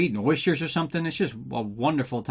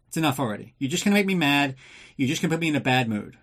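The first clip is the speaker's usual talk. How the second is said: ordinary talk, nothing marked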